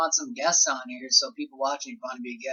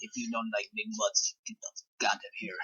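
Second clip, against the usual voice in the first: mock Russian accent, trailing off softer; 6 semitones higher, 10 dB quieter than usual. Shown as a demonstration. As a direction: joke Russian accent, hurried at the end. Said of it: "My Russian accent".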